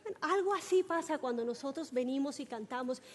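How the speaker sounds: usual speaking voice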